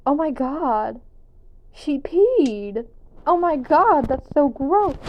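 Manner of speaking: imitating a grossed-out classmate; disgusted classmate. Classmate next to her reacting, mock disgust.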